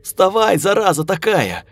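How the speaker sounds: shrieking. A narrator's reading. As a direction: screech it, furious at the pig. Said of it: "заверещала я".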